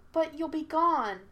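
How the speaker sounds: put-on high, slow, small boyish voice; a forlorn child's small voice. A narrator's reading. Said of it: "in a small voice".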